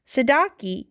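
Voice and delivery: usual speaking voice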